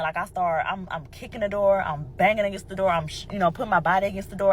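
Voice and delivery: usual speaking voice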